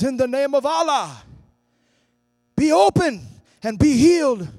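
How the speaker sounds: high, loud healing command; commanding shout. Retold or imitated. Evangelist commanding deaf ears to open, shouting. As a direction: shout the command over the girl.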